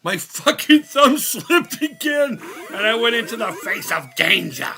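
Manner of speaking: half laugh, half shout at your own mistake; frustrated yell. Real, not acted. Thumb slipped, laughing frustration.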